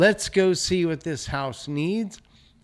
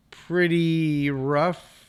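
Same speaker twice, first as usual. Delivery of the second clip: pretty rough stretched slowly and a bit louder; drawn out; 4 semitones higher, 4 dB louder, much slower than usual. Real, not acted. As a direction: linger on pretty rough, rising. Drawn-out verdict on the house.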